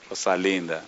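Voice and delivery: low, soft aside; hushed aside. Real, not acted. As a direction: drop right down to savour it quietly. Soft aside between loud questions.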